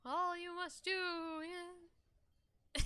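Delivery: slow, drawn-out dying ghost; milk every word like a dying spirit; overacted death scene. Ghost continues the prophecy.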